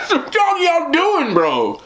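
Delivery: high, drawn-out question through laughter; incredulous laughing. Real, not acted. Asking complainers what they are doing, incredulous laughing. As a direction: laugh through it, pitch way up, then drop on the last word.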